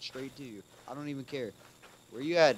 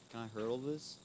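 ordinary delivery

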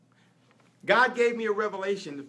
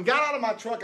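ordinary talk, nothing marked

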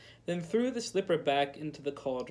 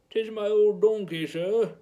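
put-on old villager; 5 semitones higher, 8 dB louder than usual. A narrator's reading. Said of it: "he explained".

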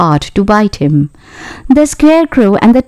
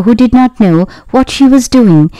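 usual speaking voice

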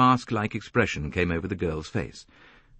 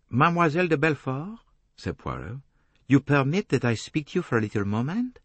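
put-on higher, accented detective voice; courteous detective asking to speak, pitched up; Poirot, courteous; 7 semitones higher than usual. Detective asks a young woman for a moment, courteous French-accented voice.

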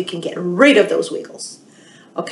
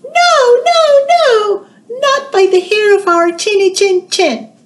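very high, loud squeaky piglet voice; shrill defiant little pigs; piggies refusing; 13 semitones higher, 6 dB louder than usual. Acted.

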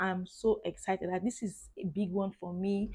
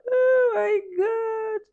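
very high, slow, crying with happiness; tearful joy; 6 dB louder, much slower than usual. Real, not acted. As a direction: sob it out in disbelief. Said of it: "I'm so excited".

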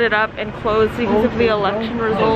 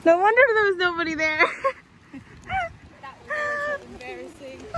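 laughing; 13 semitones higher, 4 dB quieter than usual. Real, not acted. Laughing at own embarrassing mistake.

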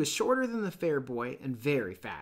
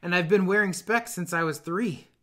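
higher and louder schoolboy, pleased with himself; fat boy's voice; 4 semitones higher, 7 dB louder than usual. Acted. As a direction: brag about the specs. Fat boy boasts of specs.

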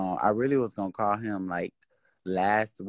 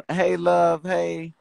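much higher sing-song "Hey, love"; mock sweet greeting; 9 semitones higher, 3 dB louder, much slower than usual. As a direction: coo it like a fake-friendly hello. Mocking chirpy live greeting.

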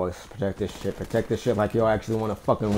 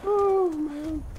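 laughing, voice jumps up high. Real, not acted. Laughing at teammate's grenade.

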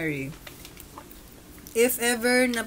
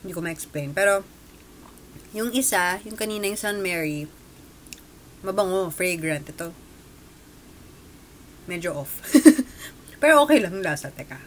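chewing while talking; pastry pressed to lips; mouth full; 4 dB louder than usual. Real, not acted. Seen 4: Pastry held at lips, mouth working on it throughout the words.

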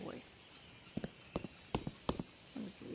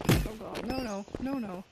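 panicked loud pleading; frantic no no no; terrified horror victim; 8 dB louder than usual. Real, not acted. Panicking as enemy attacks.

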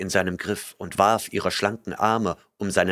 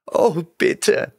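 much higher, trembling voiced plea; desperate pleading cry; frightened plea; 9 semitones higher, 4 dB louder than usual. A narrator's reading. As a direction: beg him in fear. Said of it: "Sie flehte und zitterte vor Angst".

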